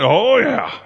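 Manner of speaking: high, whooped imitation of an eager believer; eager agreement. Man replies to preacher asking about trusting God, eagerly. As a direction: answer with comic over-eagerness.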